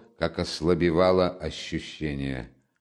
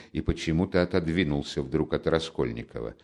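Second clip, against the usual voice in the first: plain reading voice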